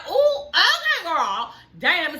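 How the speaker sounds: put-on high laughing ooh okay girl; impressed ooh. Vlogger reacting to Mia's jab, high laughing surprise. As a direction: gasp with delight at the shade. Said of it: "I said".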